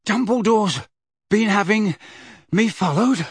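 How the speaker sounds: out of breath; panting. A narrator's reading. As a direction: pant the words out. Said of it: "Harry panted".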